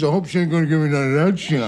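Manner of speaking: mock Morris the cat voice; talking cat voice. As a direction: rattle it off as a cartoon cat. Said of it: "he says".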